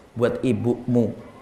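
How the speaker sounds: soft, slow reveal of a surprise. Retold or imitated. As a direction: let the answer land slowly and gently. Husband reveals gift softly. (suami membisikkan pelan kepada istrinya bahwa hadiah itu untuk ibunya)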